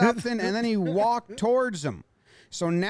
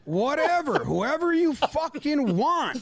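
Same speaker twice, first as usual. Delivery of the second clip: higher, slow mock disbelief; mock manager; 5 semitones higher than usual. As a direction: draw it out, stunned. Manager and umpire exchange.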